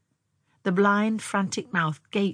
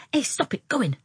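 scolding; 8 semitones higher, 3 dB louder than usual. Acted. Girl scolding the wriggling mole.

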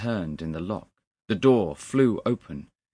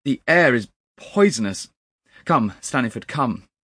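loud, higher, alarmed command; urgent cry; 5 semitones higher, 9 dB louder than usual. A narrator's reading. Narrator calls Staniford out of the poisonous room, urgent and alarmed. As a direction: urge him away at once. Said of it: "I cried".

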